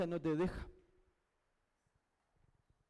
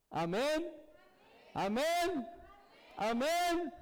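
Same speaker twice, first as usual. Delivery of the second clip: amens climbing higher each time; rising preacher's cry; rousing amens; 7 semitones higher, much slower than usual. Real, not acted. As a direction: call it out to the congregation, building. Preacher calls amens, building.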